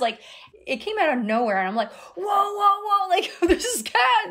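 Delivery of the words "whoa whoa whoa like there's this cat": high, loud startled whoa with a laugh. Retold or imitated. Retelling a night visit, startled by a sudden cat. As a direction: jump at the cat in the dark.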